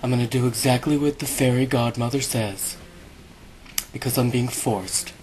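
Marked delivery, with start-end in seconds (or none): none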